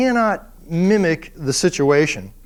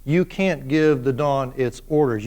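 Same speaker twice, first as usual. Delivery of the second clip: ordinary talk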